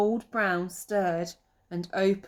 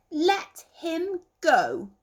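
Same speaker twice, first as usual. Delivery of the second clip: high, loud and slow command; brave demand; 10 semitones higher, 5 dB louder, much slower than usual. Acted. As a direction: stand up to the bully. Peter confronts the owl.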